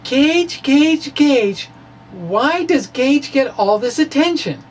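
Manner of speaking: high, whiny jealous sister; whining Jan. Retold or imitated. Quoting Jan's jealous whine from the Brady Bunch, high and whiny. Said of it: "As Jan used to say on the Brady Bunch".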